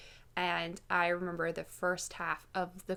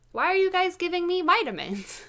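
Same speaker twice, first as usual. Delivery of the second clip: inquisitive; 9 semitones higher, 4 dB louder than usual. Retold or imitated. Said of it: "I was very inquisitive".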